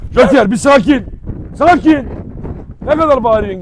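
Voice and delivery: calling out. Real, not acted. Calming a barking dog.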